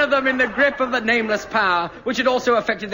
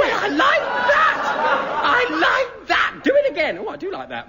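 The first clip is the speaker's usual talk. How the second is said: shrill mock outrage, laughing; high indignant outburst; 13 semitones higher than usual. Real, not acted.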